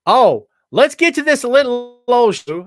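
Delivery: big, slow, high hype-man kickoff; show announcer. Acted. Host kicking off the show for his viewers, in a loud slow announcer voice.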